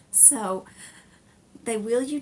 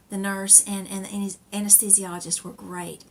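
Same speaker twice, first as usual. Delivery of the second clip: ordinary talk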